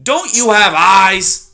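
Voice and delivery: loud, slow, scornful screech; loud slow screeching porcupine. Acted. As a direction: screech at the fool. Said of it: "Aerith screeched".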